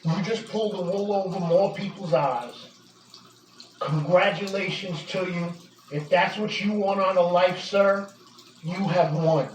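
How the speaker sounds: low, measured, solemn verdict; controlled disappointment after the fury; grave reproach. Real, not acted. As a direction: deliver a sober final judgment, pitch dropped low. Grave, lowered verdict after the outburst.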